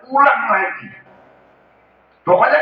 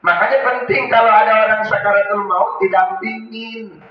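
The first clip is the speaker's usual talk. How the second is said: usual speaking voice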